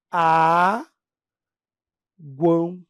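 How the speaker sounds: a and guão said as separate beats; syllables split apart. Shown as a demonstration. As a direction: break it up slowly: a, guão. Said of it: "Se fosse representar foneticamente".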